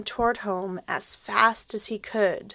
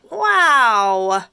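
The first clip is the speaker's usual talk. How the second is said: loud, drawn-out amazed cry; be wowed out loud; 11 dB louder than usual. Acted. Kelp amazed by what the unicorns show him, loud wow.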